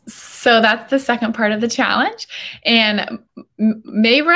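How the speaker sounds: ordinary talk, nothing marked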